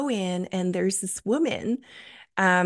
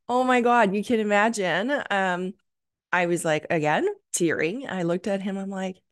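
ordinary delivery

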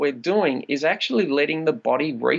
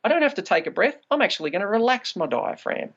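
higher, mildly reassuring inner voice; calm self-talk; 6 semitones higher than usual. Retold or imitated. Telling yourself you won't die, a touch reassuring. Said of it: "then you can just say".

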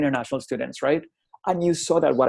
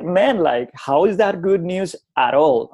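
louder, higher, exasperated retort; exasperated disbelief; 5 semitones higher, 5 dB louder, slower than usual. Retold or imitated. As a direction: retell it as a baffled, loud objection. Retold exasperated reply to student.

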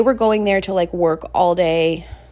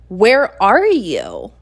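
slow, louder, pointed question; tense demand; 5 dB louder, much slower than usual. Retold or imitated. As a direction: draw each word out, holding back panic. Worried wife confronting husband.

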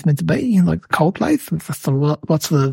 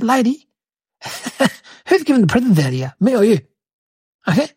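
higher-pitched, mildly indignant retort; indignant challenge; 5 semitones higher than usual. Retold or imitated. Santa telling off a bossy mum, mildly indignant. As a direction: challenge her with a laugh breaking in.

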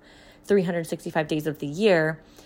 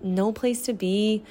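usual speaking voice

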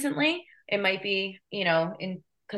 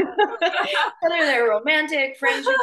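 laughing; 10 semitones higher, 4 dB louder than usual. Real, not acted. Laughing while listing relationships.

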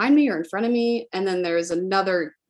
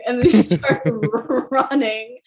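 ordinary delivery

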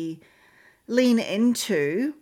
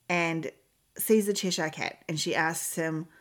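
usual speaking voice

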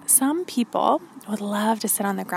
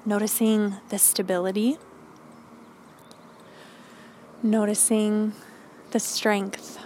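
calm grounding exercise. Acted. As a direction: guide them gently into stillness. Leads grounding meditation.

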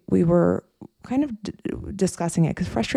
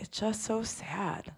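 slow and soft; hushed sadness; 5 semitones higher, 12 dB quieter, much slower than usual. Retold or imitated. Client telling her coach she is sad, softly. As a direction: say it as if it weighs on you.

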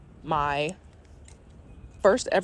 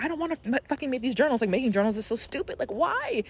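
retold crying rant; 7 semitones higher, faster than usual. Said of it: "I remember crying and being like".

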